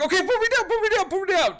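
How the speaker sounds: very high, loud squeal; panicked drinker. Acted. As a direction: squeal to be let down from a keg stand. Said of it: "you're like".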